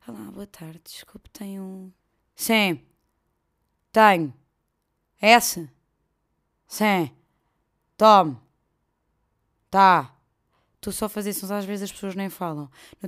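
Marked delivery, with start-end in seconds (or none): 0.1-1.9 whispered, hesitant customer asking softly; timid whisper. Retold or imitated. Customer whispering timidly to a deathly clerk. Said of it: "é como se estivessem a falar com a morte". 2.3-10.1 loud, blunt one-word answers; curt clerk. Retold or imitated. Grumpy shop clerk snapping curt answers at customer. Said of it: "é como se estivessem a falar com a morte".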